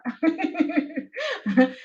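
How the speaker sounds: light giggling laugh; amused chuckle. Real, not acted. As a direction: let out a short run of giggles. Chuckles as time runs out.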